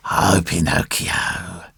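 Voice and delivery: slow, soft, low tearful fox. A narrator's reading. Old fox begging from the puppet, tearful. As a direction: wail feebly and slowly. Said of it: "he cried in a tearful voice".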